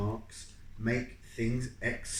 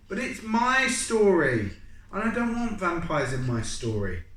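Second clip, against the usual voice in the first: indignant; 7 semitones higher, 6 dB louder than usual. Acted.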